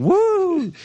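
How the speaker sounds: high imitated whoop; mock cheering yell. Player imitates crewmate's cheering, high mock whoop. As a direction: mimic an over-keen cheerleader's woo. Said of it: "Just yelling".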